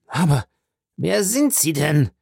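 dying man asks his killer's judge; bewildered question. A narrator's reading. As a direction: demand to know who he is.

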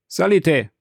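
commanding. A narrator's reading. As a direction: call it out as a command. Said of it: "mit einem Ton, als gäbe er seinem Bedienten einen Befehl". (im Befehlston)

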